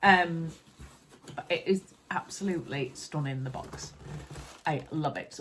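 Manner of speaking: usual speaking voice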